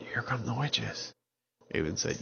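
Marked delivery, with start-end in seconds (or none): 0.0-1.1 whispering. Acted. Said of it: "they were whispering".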